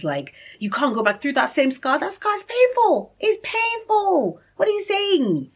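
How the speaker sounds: high wailing protest, retold; imitated tearful pleading. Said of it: "I just started crying".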